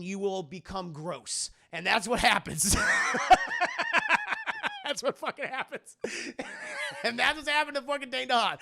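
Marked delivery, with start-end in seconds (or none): 2.8-4.8 high cackling laugh; real helpless laughter; loud real giggle fit; burst of laughter. Real, not acted. Cracking up at absurd plot. 4.8-8.6 words broken by laughter; real laughter through the sentence; giggling while talking, higher; laughing. Real, not acted. Cracking up at absurd plot.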